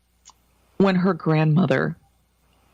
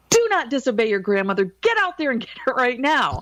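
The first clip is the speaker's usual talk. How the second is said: stern scolding; 7 semitones higher than usual. Retold or imitated. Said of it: "I just turned around and I said".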